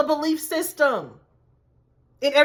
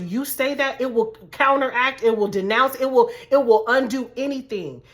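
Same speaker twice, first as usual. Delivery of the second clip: ordinary talk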